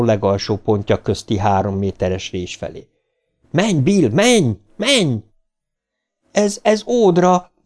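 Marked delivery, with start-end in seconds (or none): 3.5-5.2 high, swooping cries; high-pitched repeated call; urgent yelling. A narrator's reading. Urging Bill to run. 6.3-7.5 high, drawn-out despair; slow, high, faltering shout; desperate cry. A narrator's reading. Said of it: "kiáltotta kétségbe esetten Bill".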